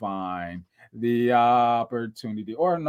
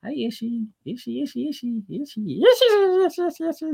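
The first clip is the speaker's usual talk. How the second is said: put-on high, cooing baby talk; 12 semitones higher than usual. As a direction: coo the name up high.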